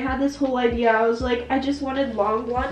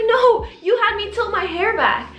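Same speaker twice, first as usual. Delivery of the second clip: high-pitched objection; retold protest; 8 semitones higher than usual. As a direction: re-enact contradicting the stylist.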